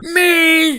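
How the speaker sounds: high, loud hammed-up surprise; surprised. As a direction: overact being picked at random. Audience plant volunteers.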